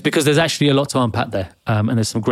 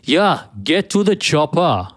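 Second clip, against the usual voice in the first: slow, drawn-out Austrian line, a bit higher; Arnie impression; 4 semitones higher, slower than usual. Host in character after warming up in German, slow mock Austrian. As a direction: lean into Arnold's accent, stretching the words. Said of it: "Let's get in character".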